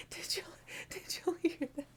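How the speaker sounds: hushed unvoiced whisper to the cat; whispering to her hiding cat. Real, not acted. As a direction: whisper it so the cat won't startle.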